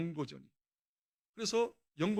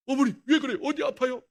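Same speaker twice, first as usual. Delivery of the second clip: loud, higher startled question; alarmed cry; 5 semitones higher, 10 dB louder than usual. Retold or imitated. Drunk son finding his mother weeping, loud alarmed higher cry. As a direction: cry out in sudden worry for her.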